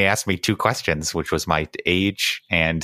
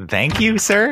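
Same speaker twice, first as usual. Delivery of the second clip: high awkward thanks; 13 semitones higher, 3 dB louder, slower than usual. Acted.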